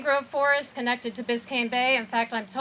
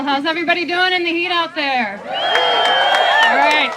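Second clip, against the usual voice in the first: calling out to the crowd; 4 semitones higher, 9 dB louder than usual. Real, not acted. Speaker calls out to rally crowd.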